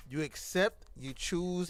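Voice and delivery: usual speaking voice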